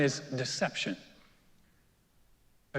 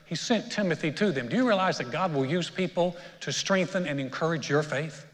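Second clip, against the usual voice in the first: usual speaking voice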